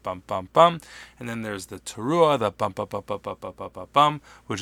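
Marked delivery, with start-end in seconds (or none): 0.0-0.8 three short rising vocal blasts; shofar shevarim imitation. Shown as a demonstration. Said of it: "that's the broken in three sound". 2.4-4.6 quick clipped vocal horn bursts; nine short punchy hums; staccato blasts. Shown as a demonstration. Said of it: "nine short staccato blasts".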